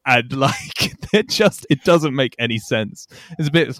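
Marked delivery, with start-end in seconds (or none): none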